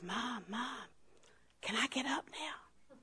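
put-on slow, hushed whisper as a child; child's whisper. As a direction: whisper carefully to mom, afraid to break the moment. Frozen child whispers to mother.

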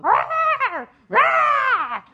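loud, high barking yells; two sharp shouted barks; imitated dog bark.

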